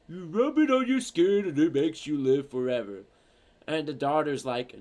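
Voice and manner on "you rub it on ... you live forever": dopey cartoon sidekick voice; Patrick. Sidekick pitching chocolate to old lady, dopey character voice. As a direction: say it like a dim-witted cartoon pal. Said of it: "Patrick's like".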